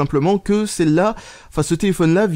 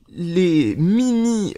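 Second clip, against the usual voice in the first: typing aloud; much slower than usual. Real, not acted. Dictates tweet while typing.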